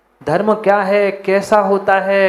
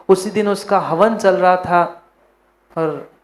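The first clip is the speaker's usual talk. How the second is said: ordinary delivery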